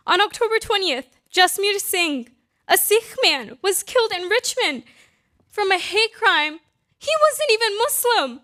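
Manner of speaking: pained appeal for the murdered; anguished plea. Real, not acted. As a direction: let the grief push the voice up. Listing hate crime victims, grieving.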